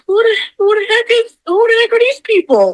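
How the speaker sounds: high-pitched, mock-bewildered demand; retold startled question. Resident spotting strangers in her kitchen, high startled retelling.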